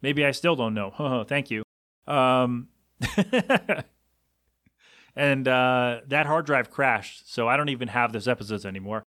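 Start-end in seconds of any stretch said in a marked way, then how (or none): 3.0-3.8 high, rapid giggle; self-mocking laugh. Real, not acted. Podcaster recalling his bad early episodes, giggling high. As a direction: laugh at your own expense, pitched up.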